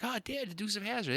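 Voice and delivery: a hint of an eager six-year-old; imitating son. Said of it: "he's like".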